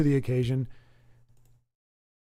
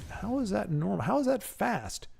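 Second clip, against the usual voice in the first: incredulous complaint; 5 semitones higher than usual. Acted. Streamer complaining about the late food, high and incredulous. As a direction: protest the wait with mock indignation.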